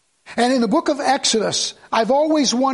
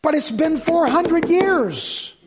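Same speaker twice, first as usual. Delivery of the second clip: exasperated thought; 6 semitones higher, much slower than usual. Retold or imitated. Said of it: "I found myself thinking".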